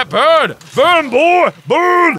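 loud gleeful yell; triumphant shout. Real, not acted. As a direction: yell it as the fire hits. Flamethrowing enemies, yelling.

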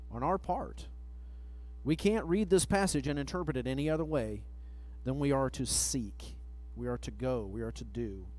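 ordinary talk

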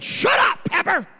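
high, loud voiced shout at Pepper; yelling at dog. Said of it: "I look over at him and I said".

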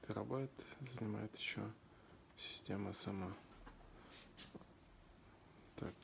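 ordinary delivery

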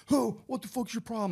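high-pitched mock outburst from a stranger; confrontational stranger. Acted confrontation after bumping shoulders.

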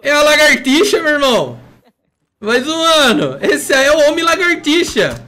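hyped, loud and high; excited shout. Real, not acted. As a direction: yell it out, amazed. Hyped about enemy.

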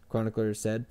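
usual speaking voice

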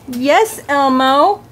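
low puppet voice with a questioning lift; deep puppet voice. Pretend. Puppet greeting Elmo, low voice. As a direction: answer low, like the big puppet.

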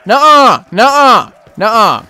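high, loud yelps settling down; alarmed refusal. Real, not acted. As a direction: refuse in a panic, easing each time. Refusing as zombies close in.

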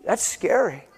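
ordinary talk

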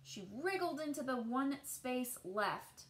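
put-on gentle read-aloud voice for children; storybook narration. A narrator's reading. Teacher reading a picture book to children, gentle storytelling. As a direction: tell the tale as if to a class at storytime.

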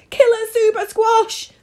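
high, loud shriek of alarm; panicked yell. A narrator's reading. Fleeing townspeople warn each other, yelling. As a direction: yell it at the top of the voice. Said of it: "they yelled".

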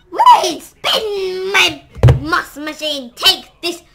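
really weird voice. Acted. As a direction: yell the attack like a cartoon hero. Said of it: "whenever I say a really weird voice".

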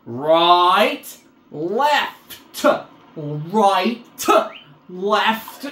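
each word slow with a rising tune; teacherly drill. Shown as a demonstration. Gamer mockingly teaching his avatar left and right, slow drill. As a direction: spell it out like a patient teacher. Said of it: "I'm gonna teach you left and right".

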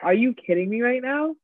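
incredulous, pitch climbing to the end; retold disbelief. Clerk retelling her reply to money-throwing customer, incredulous.

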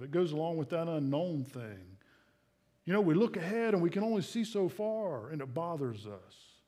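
usual speaking voice

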